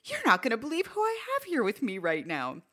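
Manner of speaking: excited tease. Retold or imitated. Teasing manager on phone.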